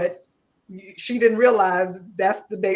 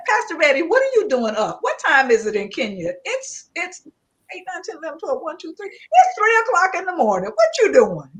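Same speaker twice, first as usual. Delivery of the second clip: excited teasing; 8 semitones higher, 3 dB louder, faster than usual. Real, not acted. Teasing friend awake at 3am.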